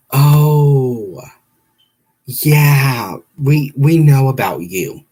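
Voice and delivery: loud, drawn-out receptionist impression; mock knowing phone-desk voice; call handler.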